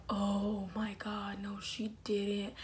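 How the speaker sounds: slow, horrified whisper; dismayed whisper. Retold or imitated. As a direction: whisper it in drawn-out disbelief. Dismayed aside on hearing mom honk.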